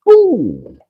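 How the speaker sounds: high, loud sudden ooh; a jolt of real surprise; startled ooh. Real, not acted. Reacting to a near miss, a loud startled ooh.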